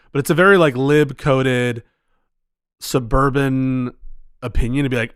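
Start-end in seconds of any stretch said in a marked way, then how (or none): none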